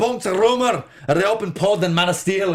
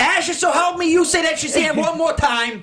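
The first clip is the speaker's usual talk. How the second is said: mock scolding; 10 semitones higher than usual.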